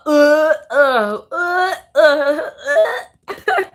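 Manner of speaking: high, repeated whiny "uh"; high wails of disgust; exasperated wailing groans. Real, not acted. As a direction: groan as if about to cry. Groans at the star sign found.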